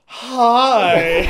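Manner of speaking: breathy come-on hello into the phone; mock seductive Tracy voice; sultry phone voice. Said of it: "I started doing this thing".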